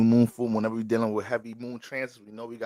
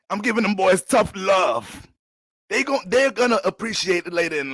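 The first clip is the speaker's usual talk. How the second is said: tough-love father; 6 semitones higher, 3 dB louder than usual. Retold or imitated. Father continuing his defence.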